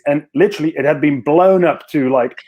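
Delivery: usual speaking voice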